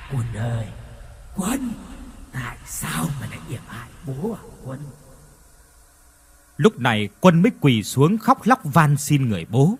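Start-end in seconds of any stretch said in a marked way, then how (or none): 0.1-4.8 soft hollow far-off ghost calling; ghostly whisper. Acted. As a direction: lure him in with a dead voice. Ghost calls son eerily. (hồn ma thì thầm gọi con)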